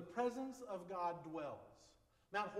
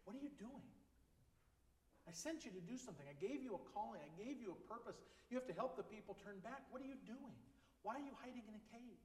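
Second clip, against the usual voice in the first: soft, hushed inner voice, gently reproving; still small voice; 3 semitones higher, 7 dB quieter than usual. Acted. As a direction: speak softly, like a conscience pressing its questions. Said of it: "that still small voice inside".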